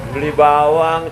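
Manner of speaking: ordinary talk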